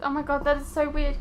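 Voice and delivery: ordinary talk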